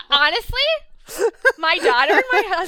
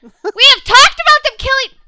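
exasperated; 10 semitones higher, 11 dB louder than usual. Real, not acted. Exasperated rant.

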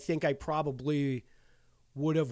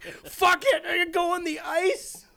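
high, laughing give-up; laughing resignation; 13 semitones higher, slower than usual. Retold or imitated. Player giving up and heading onto the ice, laughing. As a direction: laugh through it while giving in.

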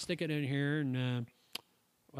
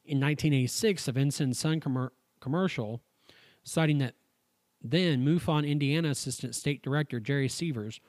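louder, even reading-aloud voice; 5 dB louder than usual. Acted. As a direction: read the article out like a report. Reads the UFO article aloud.

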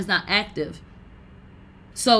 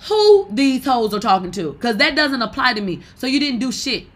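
heated dismissal; 3 semitones higher, 3 dB louder than usual. Real, not acted. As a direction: brush them off, loud and scornful. Loud dismissive rant.

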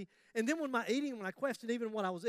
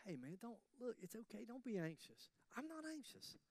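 Paul soothing his friend from prison, quiet and calm; soft, hushed reassurance, far softer than the sermon; 14 dB quieter than usual. Retold or imitated. Imprisoned Paul calmly reassuring a worried friend, hushed. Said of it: "So Paul said".

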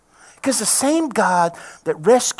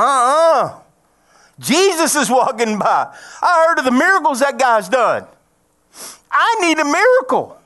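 higher, louder, desperate insistence; blind man pleading; 6 semitones higher, 6 dB louder than usual. Retold or imitated. Blind man answering crowd, raised desperate plea. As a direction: refuse to be silenced, beg. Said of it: "He went".

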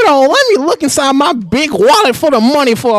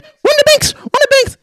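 high squawky parrot mimic; parakeet voice. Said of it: "the parakeet's saying".

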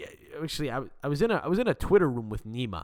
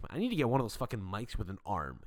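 ordinary delivery

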